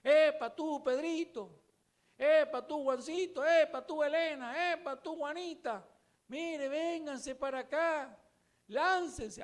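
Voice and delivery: high, loud calls, name after name; calling out. Acted. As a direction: shout down to companions far below. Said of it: "empezó a gritarles a todas".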